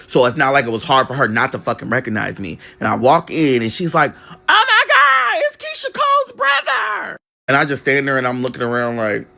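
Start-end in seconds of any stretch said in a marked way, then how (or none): 4.3-7.2 shocked classmate shriek. Retold or imitated. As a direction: shriek it like you just spotted a celebrity. Classmate recognizes him, screams.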